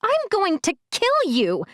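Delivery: big sister scolding her brother; high, exasperated girl's voice. A narrator's reading. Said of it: "the girl said".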